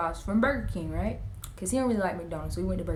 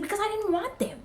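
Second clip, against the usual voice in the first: high little brother voice; 12 semitones higher than usual. Retold or imitated. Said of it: "He was like".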